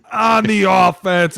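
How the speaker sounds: very high, loud and slow mock ref announcement; flexing ref call. Jacked ref flexing on the mic, slow and loud. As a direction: strain it out like a jacked ref showing off. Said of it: "every striation of his muscle".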